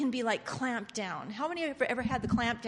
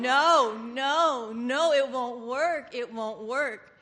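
ordinary delivery

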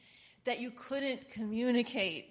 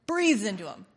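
audible breath in; 12 dB louder than usual. Shown as a demonstration. Demonstrating Jesus breathing on them.

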